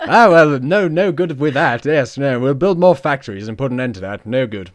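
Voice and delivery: loud, hammed-up imperial bluster; British colonial chap. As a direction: dismiss it as a pompous British gent. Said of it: "the British, uh, we".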